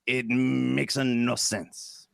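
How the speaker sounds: hammed-up French accent, stretched in places; mock French accent. Parodying a French movie's plot, mock French accent. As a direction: play a French film buff with comic accent.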